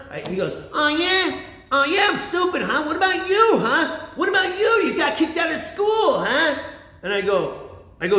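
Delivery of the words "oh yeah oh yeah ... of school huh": high, taunting mock-dad retort; indignant dad comeback. Dad getting back at his son, mock indignation. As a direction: imitate dad throwing the insult back.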